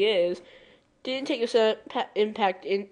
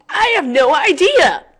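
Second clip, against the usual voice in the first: sudden louder, higher shout; loud outburst; 7 semitones higher, 10 dB louder than usual. Real, not acted. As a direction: burst out with a laugh in your voice. Shouted answer to own question.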